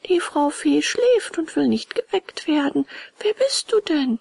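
high, deliberate snail voice; high-voiced snail doorkeeper; snail at window. A narrator's reading. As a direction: answer from above, pitched high.

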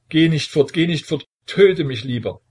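high, loud anguished plea; cling to him with your voice; desperate begging. A narrator's reading. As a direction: son at his father's feet, frantic. Son clinging, begging father.

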